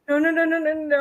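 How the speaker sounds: high, rapid string of refusals; mock panic. Dreamer waking up while needing to pee, mock panic. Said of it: "you wake up going like".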